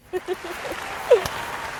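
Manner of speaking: high unvoiced giggle; breathy giggle. Real, not acted. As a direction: giggle at your own joke. Laughing after cheer.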